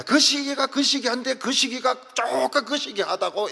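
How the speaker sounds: Chungcheong dialect. Retold or imitated. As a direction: imitate Chungcheong talk, high and loud. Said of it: "충청도 말은 무슨 말인지 모르겠어요".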